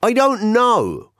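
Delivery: very high, drawn-out wail; high-pitched helpless protest; exasperated bafflement. Acted. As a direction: squeal it slowly, at a total loss. Exasperated answer to absurd question.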